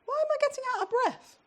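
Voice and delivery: imitating husband short of breath; breathless husband. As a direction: ask it as a man out of breath on a slope. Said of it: "and he says".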